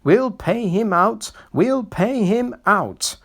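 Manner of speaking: high, sing-song plotting chant; scheming coaches. A narrator's reading. Coaches muttering revenge on the new engine. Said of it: "grumbled the coaches".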